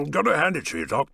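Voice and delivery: talking through a mouthful; words round a bite of food; mouth full. Acted. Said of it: "he managed to get out between mouthfuls".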